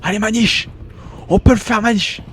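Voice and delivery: cheering. Real, not acted. Cheering on a friend.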